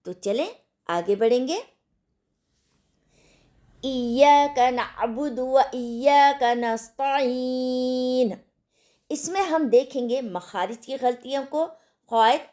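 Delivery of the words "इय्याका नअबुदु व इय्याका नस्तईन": slow, drawn-out chanted recitation; slow Quranic recitation. Shown as a demonstration. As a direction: recite it slowly and fully voiced. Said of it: "ऊंची आवाज़ के साथ आहिस्ता रफ्तार से पढ़िए".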